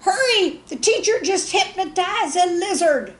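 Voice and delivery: very high, loud frantic scream; a little boy screaming with excitement. Acted. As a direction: shriek the news across the playground. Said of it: "ran screaming across the playground".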